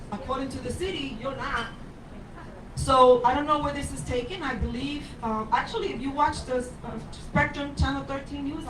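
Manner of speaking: usual speaking voice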